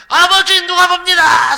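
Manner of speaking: put-on high, loud boyish yell; boy shouting a warning. Son warning his father someone is watching, high loud yell. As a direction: shout it out to warn your father. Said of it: "소리치는 거예요".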